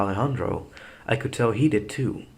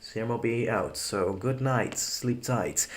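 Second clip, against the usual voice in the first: usual speaking voice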